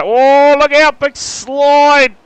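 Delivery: sudden loud alarmed call; excited shout. Real, not acted. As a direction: shout out the big slide. Commentator reacts to a slide.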